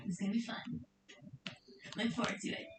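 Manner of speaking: soft secretive whisper. Pretend. Teasing fans about a hidden collab, whispered. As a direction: breathe it like a secret.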